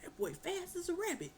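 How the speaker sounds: high, soft, fast puffing; out of breath mock father; winded father. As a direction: gasp it out after bumping your head. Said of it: "He was like".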